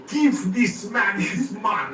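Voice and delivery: put-on slow, high Russian-accented boast; drawn out like a proud Russian strongman; mock Russian accent. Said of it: "Russian accent".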